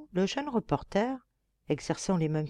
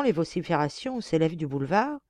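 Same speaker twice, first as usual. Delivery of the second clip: usual speaking voice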